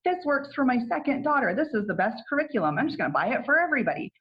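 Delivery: high, quick gushing over curriculum; imitating her own eager past self; mock excited self. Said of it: "I'll be like".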